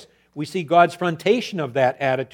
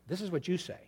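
much softer; quiet take; 2 semitones higher, 12 dB quieter, faster than usual. Shown as a demonstration. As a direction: drop the voice right down. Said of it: "quiet take, contrasted".

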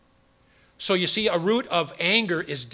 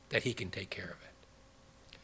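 whisper; 9 semitones lower, 13 dB quieter than usual. Real, not acted. Preacher drops to a hush.

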